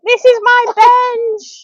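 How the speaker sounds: put-on high, slow playful squeal to the baby. Mum playing with her baby daughter in a high, drawn-out voice. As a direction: sing it out slowly for the baby.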